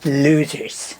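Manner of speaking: mock American politician impression; drawn-out, dismissive presidential put-down; Donald Trump. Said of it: "Just do Donald Trump one more time".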